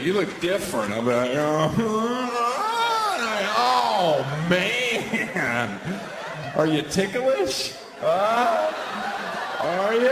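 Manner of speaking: laughing, rising, excited friend; tickling banter, giggling; acted friend quote. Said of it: "My friends be like".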